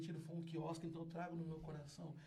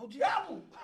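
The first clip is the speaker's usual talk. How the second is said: loud, high joking cry; father joking loudly mid-prayer; exclamation; 8 semitones higher, 12 dB louder than usual. Retold or imitated. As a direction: blurt it out loud in mock alarm.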